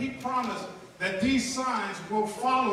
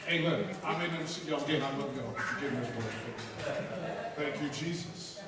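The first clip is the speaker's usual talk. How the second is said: much softer and lower, tender goodbye; soft farewell; 10 semitones lower, 8 dB quieter than usual. Real, not acted. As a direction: drop almost to a hush to say goodbye.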